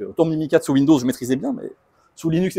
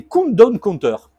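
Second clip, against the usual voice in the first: French-accented English, drawn out slowly and louder; self-mocking English accent; 7 semitones higher, 6 dB louder, much slower than usual. Real, not acted. Speaker shows countdown counters to the audience, in self-mocking English accent. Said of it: "avec mon superbe accent anglais".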